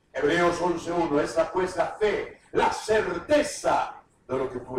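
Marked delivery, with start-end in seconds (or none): none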